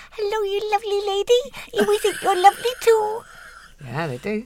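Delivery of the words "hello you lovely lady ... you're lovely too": high, squeaky little flower voice; a tiny rose answering back, squeaky high voice. Pretend. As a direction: speak as a tiny talking rose. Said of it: "the little voice goes".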